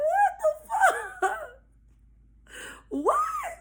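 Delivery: very high, stunned squeak; high-pitched mock shock; retold disbelief. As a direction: break it with pauses, stunned. Teller's stunned reaction, retold.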